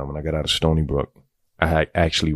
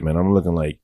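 ordinary talk, nothing marked

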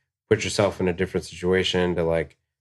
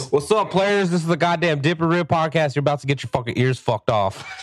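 hype intro; 9 semitones higher than usual. Pretend. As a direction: shout it like a hype host. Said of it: "say it with confidence".

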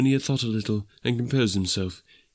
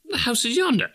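high-pitched urgent cry; exclaiming. Acted. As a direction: protest to the spirit in a high, strained exclamation. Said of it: "Scrooge exclaimed".

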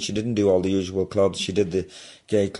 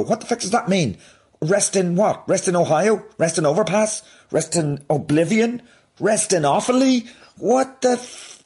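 high-pitched, exasperated disbelief; rising indignant questions, climbing to a baffled splutter; incredulous rant; 10 semitones higher, 4 dB louder than usual. Acted. Comedian puzzling over a botched condolence, incredulous rant. Ranting at nonsense condolence abbreviation.

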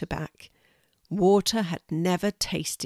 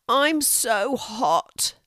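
drawn-out, groaning complaint, higher and slow; 5 semitones higher, much slower than usual.

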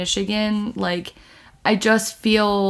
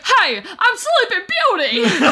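Sleeping Beauty; 11 semitones higher, 3 dB louder than usual. Retold or imitated. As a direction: imitate a friend's silly princess greeting. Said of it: "She talks like that".

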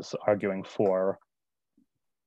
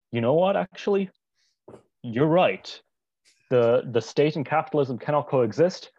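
higher, louder voice of sudden conviction; quoting sister; 4 semitones higher, 4 dB louder than usual. Retold or imitated. Brother retelling his sister's reply in a debate, raised emphatic voice. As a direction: imitate a sister emphatically conceding the point. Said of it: "my sister said".

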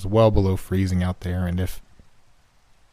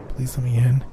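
put-on pleading child voice; 5 semitones higher than usual. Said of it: "I let out a scream".

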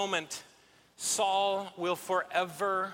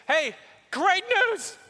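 excited announcement; 10 semitones higher, 4 dB louder than usual. Acted. David bursts in announcing.

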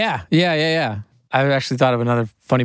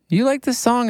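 lightly raised, casual question; 12 semitones higher than usual. Retold or imitated. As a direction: toss the question up high and easy. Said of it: "he was like".

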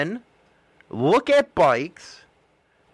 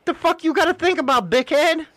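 mock exasperated retort; 8 semitones higher than usual. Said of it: "So it's like".

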